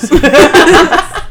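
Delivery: hysterical laugh. Real, not acted. Group cracking up.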